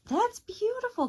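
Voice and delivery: real delight at the unfolded snowflake; delighted praise. Real, not acted. Child shows finished snowflake.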